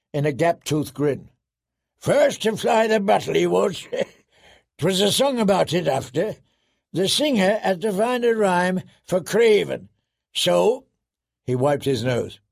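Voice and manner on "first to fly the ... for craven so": blustering wildling telling a mocking tale; high, amused boisterous voice; Tormund. A narrator's reading. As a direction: gloat like a rowdy storyteller. Voices Tormund, gleeful mockery.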